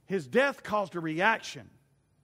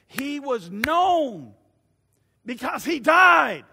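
very slow, louder, higher punch on died; climactic emphasis; 7 semitones higher, 12 dB louder, much slower than usual. Real, not acted. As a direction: hammer home the point. Preacher's climactic point.

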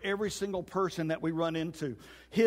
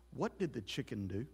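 low, hushed parrot question; timid parrot; 6 semitones lower, 10 dB quieter than usual. Acted. Parrot asking about the chicken in the freezer, low and hushed. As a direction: ask it very softly, as a wary punchline. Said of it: "He looked back at the freezer".

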